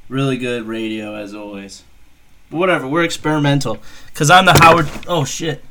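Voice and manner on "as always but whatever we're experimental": ordinary talk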